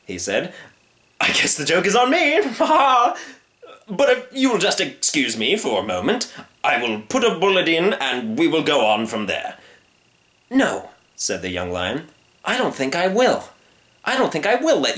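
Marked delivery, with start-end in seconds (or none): 1.2-3.2 very high, forced laughing admission; sheepish joke with a fake ha ha; embarrassed hunter. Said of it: "the hunter was very embarrassed". 3.9-9.6 higher, over-polite put-on voice; fussy storybook hunter; embarrassed hunter. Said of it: "the hunter was very embarrassed". 10.5-10.9 higher, firm refusal; decided young lion; defiant young lion. Acted.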